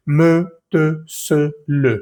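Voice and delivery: "Me, te, se, le" each with a full French e; spaced French words, the e pronounced; French e sounded. Shown as a demonstration. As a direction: say "Me, te, se, le" as separate voiced syllables. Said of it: "ahí sí pronunciamos la E".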